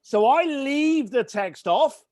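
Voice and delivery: ordinary talk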